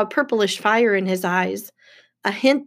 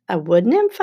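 puzzled echo, swooping upward; incredulous question. Acted. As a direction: repeat it as if you misheard. Percy repeats in disbelief.

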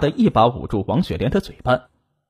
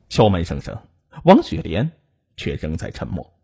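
ordinary delivery

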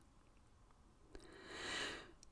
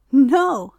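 playful wind laughing; 5 semitones higher, 6 dB louder than usual. A narrator's reading. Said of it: "laughed Wind".

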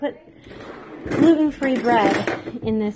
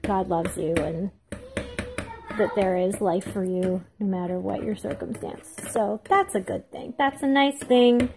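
ordinary delivery

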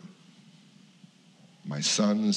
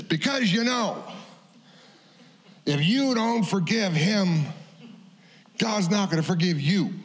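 put-on stern old minister; 11 semitones higher than usual. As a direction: lecture him like a bony-fingered old preacher. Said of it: "points his bony finger in my face and he says".

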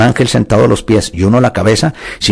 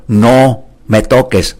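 slow emphatic quote; much slower than usual. Acted. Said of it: "Jesús le dijo".

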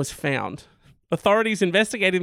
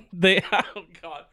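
usual speaking voice

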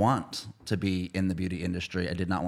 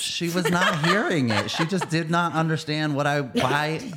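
laughing; 6 semitones higher, 4 dB louder than usual. Real, not acted. Laughing through a retold story.

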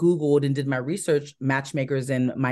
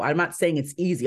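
ordinary talk, nothing marked

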